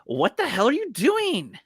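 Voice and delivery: high, slow woman's voice; man doing a woman's voice. Pretend. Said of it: "I'll do the girl's voice".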